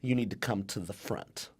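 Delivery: a hint of a slow, drawling English teacher; teacher's drawl. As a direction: drag it out like an old-school Texas teacher. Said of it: "She talked like this".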